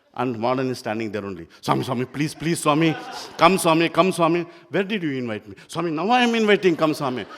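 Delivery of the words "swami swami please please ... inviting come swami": imitating boys begging, louder and higher; loud pleading. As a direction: beg him loudly to come in. Boys begging, Swami refusing.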